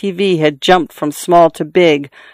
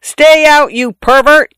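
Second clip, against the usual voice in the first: high, loud, furious yell; 10 semitones higher, 5 dB louder than usual. A narrator's reading. Daughter to father through bathroom door, furious yell. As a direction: yell it in a voice not your own. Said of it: "I yelled in a voice not mine".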